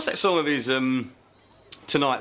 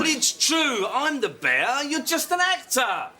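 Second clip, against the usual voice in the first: insist, rising, that he is the bear; heated insistence; 12 semitones higher, slower than usual. Real, not acted. Voice actor arguing with the suit actor, high and indignant.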